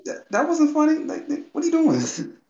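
high, squeaky disbelieving voice; hammed-up incredulous alien; baffled Zaito impression. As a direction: stare him down in shrill confusion. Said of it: "Looked at him like".

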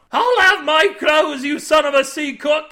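very high, loud enraged shout; furious scream. A narrator's reading. Naked man demanding his clothes, screaming. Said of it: "the naked man screamed".

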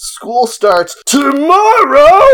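high, slow, alarmed question; shocked kid. Acted. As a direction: gasp it out as a panicked kid. Phineas reacts in the reading.

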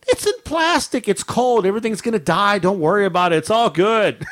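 high-voiced excuse-making, acted out; defensive dismissal. Husband excusing himself to wife, playfully defensive.

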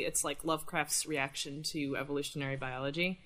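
usual speaking voice

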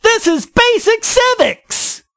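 high-pitched, exasperated disbelief; incredulous scolding. Real, not acted. Podcaster berating Mason over basic civics, incredulous.

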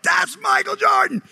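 high, drawn-out starstruck yell; excited shout. Retold or imitated. Teenager telling his friend his hero is coming, excited shout. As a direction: shout it like a thrilled teenage fan.

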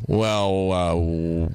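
carefully feeling out the question, with hesitant pauses; careful answering. Retold or imitated. New hire answering angry boss on first day, careful. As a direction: imitate a wary new hire choosing words. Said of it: "I'm answering everything carefully".

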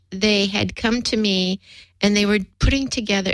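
ordinary talk, nothing marked

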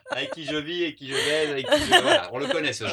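words through high laughter; laughing. Real, not acted. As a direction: laugh while teasing. Laughing at partner joke.

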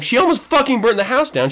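high-pitched, exasperated self-justification; indignant. Retold or imitated. Father justifying his yelling to himself, indignant.

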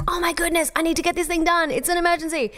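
high, frantic mock panic; hammed-up panicked fretting. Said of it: "if you're thinking".

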